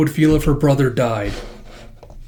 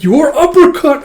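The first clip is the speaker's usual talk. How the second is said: much higher, louder, slow battle cry; anime move callout; 13 semitones higher, 8 dB louder than usual. Acted. Fighter shouts his move while uppercutting a thug. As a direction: scream the move name. Calling out attack.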